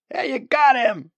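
loud, high whoop of success; triumphant yell. Acted. Man cheers friend's shot at the bird, triumphant.

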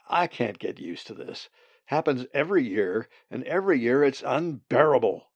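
loud complaint. A narrator's reading. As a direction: complain bitterly about the yearly cold. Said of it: "Zack complained loudly".

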